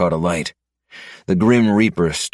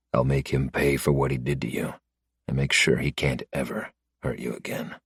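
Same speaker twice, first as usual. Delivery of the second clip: softer, low and steady promise; soft grim vow; 3 semitones lower, 7 dB quieter than usual. Acted. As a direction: swear it gently but firmly. Vowing revenge, cupping her face.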